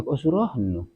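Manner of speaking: normal talking voice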